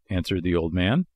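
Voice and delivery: usual speaking voice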